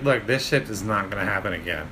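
low, controlled retold warning; cold-blooded. Storyteller quotes the woman warning her captor, cold-blooded. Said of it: "she was cold-blooded".

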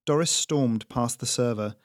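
ordinary delivery, nothing marked

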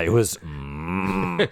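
very slow, low buzzy hum; low growl. Shown as a demonstration. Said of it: "I cannot physically get my voice low enough".

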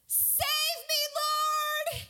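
high, strained cry; acted shout for help. A narrator's reading. Peter sinking, shouting to Jesus, high desperate cry. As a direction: cry out high in hammed-up panic. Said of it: "he shouted".

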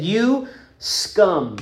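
an angry king condemning the slave; seething anger. Acted. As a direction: spit out the insult in rage. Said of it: "he said, seething with anger".

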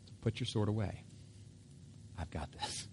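soft reassurance, dropping to a whisper; hushed, low, almost whispered by the end; Jesus assuring. Acted. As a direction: quietly take charge and calm him. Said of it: "Jesus has to try to assure Peter".

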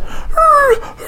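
high mock dog barking; dog growl imitation. Pit bull lunging at the raccoon, mock barking. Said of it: "she's like".

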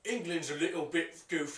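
goofy put-on English accent; mock English accent.

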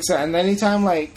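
ordinary talk, nothing marked

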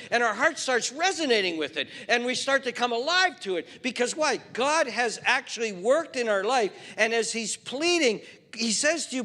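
loud, high, driving sermon voice; full-force preaching. Acted. Pastor pressing the congregation about sin, loud preaching.

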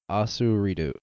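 asurito, s for th, r for l; Japanese pronunciation. Shown as a demonstration. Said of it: "them saying athlete in a Japanese accent".

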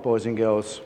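plain reading voice

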